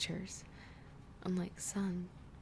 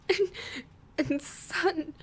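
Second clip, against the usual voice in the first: tearful broken words; 10 semitones higher, 3 dB louder, slower than usual. Acted.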